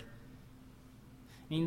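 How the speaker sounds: breathy unvoiced aside; whispered aside. Real, not acted. Preacher whispering an aside before the point. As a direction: drop to a whisper.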